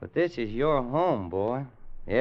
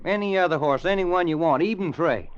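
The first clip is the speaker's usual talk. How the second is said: urgent pleading; 7 semitones higher, 5 dB louder than usual. Acted. Pleading with gunfighter.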